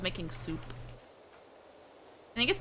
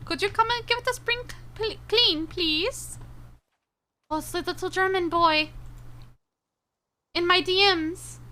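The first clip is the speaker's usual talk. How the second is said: high-pitched mock German accent; attempted German accent; 8 semitones higher than usual. Streamer playing a little German boy for chat, mock German accent. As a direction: put on a shaky-knowledge German accent, pitched well up. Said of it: "I don't know how to do a German accent".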